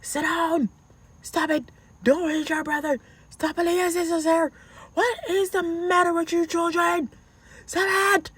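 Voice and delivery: high-pitched mock yell at the children; exasperated mom yelling, acted out; retold screaming. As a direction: scold the kids in a high, strained mock scream. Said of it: "I was screaming on top of my lungs".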